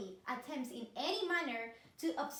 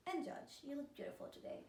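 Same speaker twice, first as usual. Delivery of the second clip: unvoiced flattering aside; whispered flattery; 9 dB quieter than usual. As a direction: slip the judge a whispered compliment.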